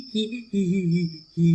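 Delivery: sly villain's hee-hee giggle; evil giggle. Acted. Village crook gloats over stolen geese, evil giggle. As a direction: cackle with glee over the plan.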